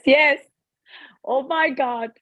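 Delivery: ordinary talk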